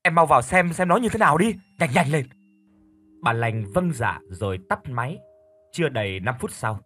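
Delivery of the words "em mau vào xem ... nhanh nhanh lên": loud, high and pressing; panicked urging on the phone; urgent. Acted. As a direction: hurry her in alarm. Brother urges her to check.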